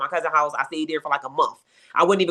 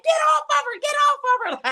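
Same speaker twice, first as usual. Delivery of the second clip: shouting to stop him. Retold or imitated. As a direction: yell it to stop the choking. Pulling him off the girl.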